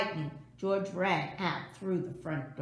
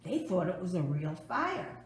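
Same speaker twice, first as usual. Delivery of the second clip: ordinary delivery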